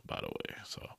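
tossed-off whisper; whispered aside. Real, not acted. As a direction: drop to a whisper for a quick side note. Whispered aside about a guest.

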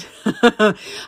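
a burst of real laughter at herself. Real, not acted. Laughing at herself while telling the viewers about her spirals, a real laugh. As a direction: break into a real laugh at the memory. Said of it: "I'm laughing to myself".

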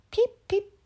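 high peeping; frog peeps. A narrator's reading. Narrator peeping high for the frog. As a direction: peep high and small. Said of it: "says the frog".

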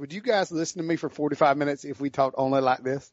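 playful Southern country accent. Shown as a demonstration. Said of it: "doing the podcast like this".

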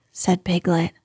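ordinary delivery

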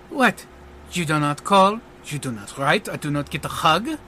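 French-accented magician, grand greeting into mock reproach; French showman, playfully hurt. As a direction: greet the room theatrically, then feign offense.

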